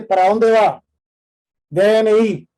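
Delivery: loud, curt guard barking at visitors; harsh, loud doorman demanding ID; rude doorman. Retold or imitated. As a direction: snap at them like a policeman at the door. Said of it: "cambie el tono de voz".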